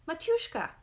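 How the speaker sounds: put-on high, loud delighted little boy; child's cry. A narrator's reading. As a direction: call out to mother in joy. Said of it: "he cried".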